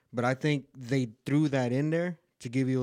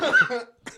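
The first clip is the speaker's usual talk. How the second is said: snort; 10 semitones higher, 7 dB louder than usual. Real, not acted. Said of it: "I did, man".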